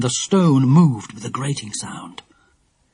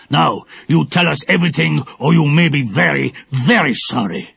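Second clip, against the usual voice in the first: menacing threat. A narrator's reading. Villain threatening the frightened girls, menacing. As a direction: threaten them coldly. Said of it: "asked the shaggy-browed man".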